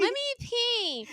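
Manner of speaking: high, stretched-out imitation; mock insistence. Mimicking her to her friend, mock insistence.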